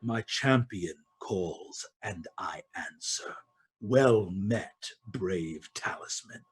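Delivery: soft, low, regal greeting; quiet, solemn queen voice; Queen of Dawn. Acted. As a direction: speak low and hushed, with ceremony. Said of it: "Queen of Dawn say".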